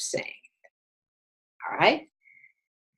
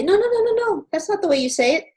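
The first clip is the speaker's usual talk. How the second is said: high, fast scolding no-no-no; much higher put-on voice, rushing the correction; mock correcting listener; 12 semitones higher, much faster than usual. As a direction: play a fussy native speaker correcting you. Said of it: "and they say".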